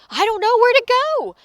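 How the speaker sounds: high, loud alarmed cry; panicked little creature's outcry. A narrator's reading. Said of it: "cried Pip Squeak".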